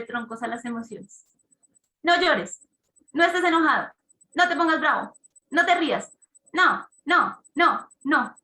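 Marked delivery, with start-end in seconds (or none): 2.0-8.4 scolding parent. Retold or imitated. Parent scolding emotions, voiced example.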